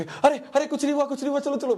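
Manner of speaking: high, hurried soothing to cover embarrassment; a grandfather quickly calming a screaming child in public, pitched high; high, anxious coaxing; flustered grandfather hushing. Retold or imitated.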